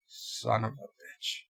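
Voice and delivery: very soft, slow and low; hushed, drawn-out variant. Shown as a demonstration. Streamer demonstrating ways to say it, hushed and slow. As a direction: draw it out almost in a whisper. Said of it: "how many different ways can you say".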